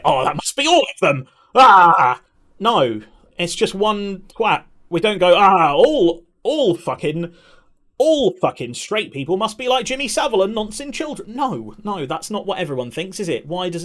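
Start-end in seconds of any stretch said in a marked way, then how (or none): 0.0-2.2 exaggerated loud panic, breaking into a yell; mock alarmed outcry. Streamer mimicking people who jump on scandals, mock alarm. 5.4-11.3 high-pitched, hammed-up alarm; mock outrage. Streamer parodying sweeping blame of straight people, mock outrage.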